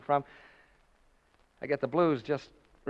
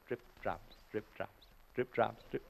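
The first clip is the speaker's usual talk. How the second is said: much softer, chanting under the breath; hushed repetition; 6 semitones lower, 11 dB quieter than usual. Acted. Announcer watching the stain drip, murmuring the drops. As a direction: murmur as each drop falls. Counting drops dripping from the ceiling.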